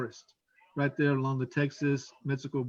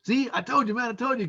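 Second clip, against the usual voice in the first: higher-pitched retold "told you so"; imitated insistent man; 6 semitones higher than usual. Man insists to officer he was right, higher mimicked voice. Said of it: "And he says".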